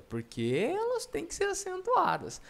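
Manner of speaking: high-pitched put-on pupil; squeaky imitation of a questioning student; mock student voice. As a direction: shoot up high, playing the student. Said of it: "Você fala".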